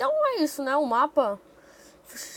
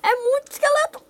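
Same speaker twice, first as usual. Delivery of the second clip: exasperated shout; 13 semitones higher, 6 dB louder than usual. Real, not acted. Swarmed by skeletons.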